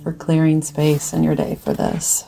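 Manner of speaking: guided meditation. Acted. Meditation leader guiding listeners to breathe from the heart, calm guided-meditation voice.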